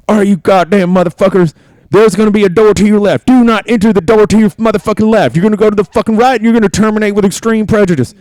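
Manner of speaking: high, loud barking drill sergeant; drill sergeant yelling. Retold or imitated. Drill sergeant yelling at recruits, barking high and loud. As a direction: scream orders at the privates. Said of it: "doing all this drill sergeant shit".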